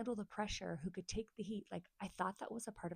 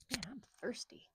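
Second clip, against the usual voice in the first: whispered aside; 5 semitones higher than usual. Real, not acted. Aside mid-sentence.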